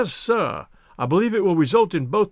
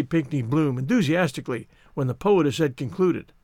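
usual speaking voice

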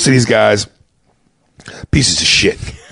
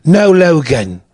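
put-on a quick British accent, a touch louder; clipped British film line; mock British accent; 5 semitones higher, 3 dB louder, slower than usual. Halle Berry's line in a light British accent. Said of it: "Halle Berry had a British accent".

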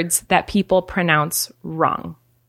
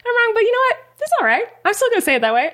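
higher-pitched, easygoing admission of being wrong; imagined gracious loser; 13 semitones higher, much faster than usual. Acted. Modelling someone being able to admit they're wrong, imagined gracious loser. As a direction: concede it lightly in a lifted voice. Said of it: "Being able to be like".

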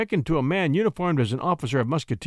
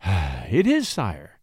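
with a sigh; 5 semitones lower, much slower than usual. A narrator's reading. Poet answering the king about his shepherd days, with a sigh. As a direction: let out a sigh, then answer low. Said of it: "answered David, with a sigh".

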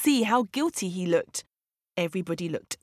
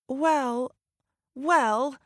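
hesitant stutter, pitched up; higher, halting, uneasy leopard; 5 semitones higher than usual. A narrator's reading. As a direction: falter nervously, pausing between the words.

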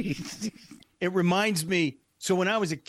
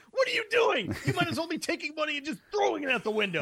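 retelling a furious dad, yelling high; angry dad shout; 10 semitones higher, faster than usual. Retold or imitated. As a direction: bark it like a fed-up father.

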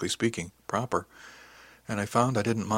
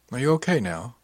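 usual speaking voice

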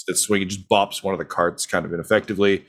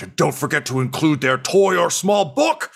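character voice; 7 semitones higher than usual. Acted. GM voicing an NPC line.

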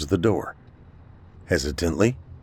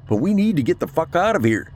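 high-pitched push to flee; urgent; 11 semitones higher than usual. A narrator's reading. Captain wants out before another attack, urgent. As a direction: press them in a high, urgent voice.

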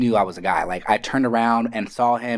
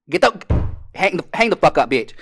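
high, louder mock shouting; playful yell; 6 semitones higher, 4 dB louder than usual. Yelling at family to stop listening, a loud playful shout. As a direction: shoo the listeners off loudly.

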